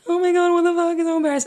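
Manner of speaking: high-pitched mock embarrassment; embarrassed girl. Imitating how girls would react to the insult, embarrassed. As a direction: squeal it like a mortified girl. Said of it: "usually girls would be like".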